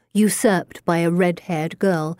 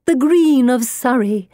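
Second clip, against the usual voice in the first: high, loud, swooning reminiscence; rapturous spirit-mother; 6 semitones higher, 4 dB louder than usual. A narrator's reading. Medium as dead mother recalls an invented garden, high gushing rapture. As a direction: gush dreamily in a high, swelling voice.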